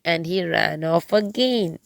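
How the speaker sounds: ordinary delivery, nothing marked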